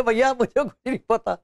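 normal talking voice

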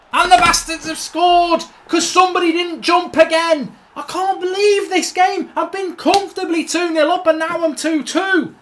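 high, loud despairing rant; furious disbelief. Real, not acted. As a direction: rage at throwing away a lead. Opponents equalise after his mistake.

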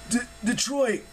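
high, startled stammer; confused, stuttered outburst. A narrator's reading. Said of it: "Jason stammered".

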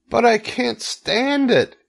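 very high, drawn-out protest; distraught shout. A narrator's reading. Wilbur protests to the spider, distraught shout. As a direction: cry out in high-pitched anguish. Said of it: "shouted Wilbur".

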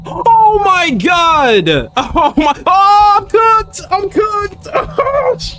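high panicked shrieks of fright; terrified screaming. Real, not acted. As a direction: scream in real terror. Jump scare in horror game.

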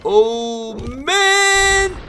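high, drawn-out exclamation; dismayed cry. Real, not acted. Commentator watching a monk get lost, dismayed cry. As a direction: wail it out, rising on the last word.

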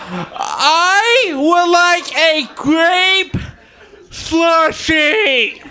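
very slow, high, drawn out; ordering a grape slushie, dragged out and high. Retold or imitated. His order at Sonic.

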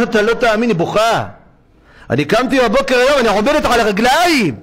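high, excited retelling of a miracle; mother telling her son she can stand; overjoyed mother. Retold or imitated.

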